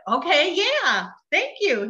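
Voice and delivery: a drawn-out okay-yeah brush-off; playing along, mildly amused; dismissive. Brushing off doctor's news.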